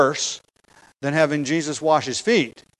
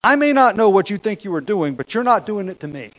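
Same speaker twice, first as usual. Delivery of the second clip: defiant refusal; 5 semitones higher, 4 dB louder than usual. Acted. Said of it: "as if Peter is saying".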